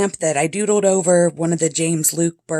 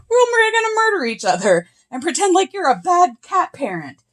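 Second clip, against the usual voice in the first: much higher cartoonish cat voice, sly and mischievous; voicing the cats; 13 semitones higher than usual. Cats plotting against their owner, in a high sly voice. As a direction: play the cats as smug little troublemakers. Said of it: "then they're like".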